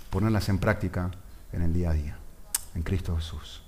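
quiet, reverent prayer voice. Acted. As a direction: pray humbly to God. Closing prayer.

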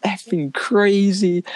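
half-laughing, imitate mom screaming at you; mock angry scream. Mom yelling at her kid after the crash, laughing mock scream. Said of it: "starts screaming and cussing".

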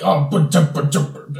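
high, loud mock grumble while counting coins; a hammed-up villain muttering over his money; mock Stromboli mumble. Hammed-up Stromboli grumbling over coins. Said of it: "he's like".

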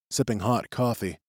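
ordinary delivery

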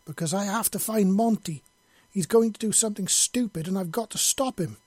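high, loud and urgent; panicked yell. A narrator's reading. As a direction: yell with a hard note of panic. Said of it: "yelled, a hard note of panic".

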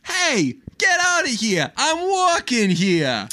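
high, slow, hammed-up New York yell; New York tough guy. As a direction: play an outraged New Yorker, way up high. New York cabbie bit.

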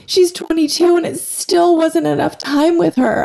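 high, teary voice breaking; choked up, fighting tears; tearful mom missing her daughter; crying. Real, not acted. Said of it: "I cried".

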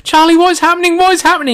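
very high, loud panicked wail; re-enacted shrill-pitched panic; freaking out. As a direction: demand answers in hysterics. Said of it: "I'm freaking out".

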